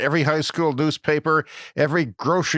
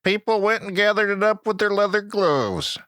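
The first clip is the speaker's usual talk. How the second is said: put-on Georgia accent; 6 semitones higher than usual. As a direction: play a country kid from Georgia in a hammed-up Southern accent. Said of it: "This is a Georgia accent".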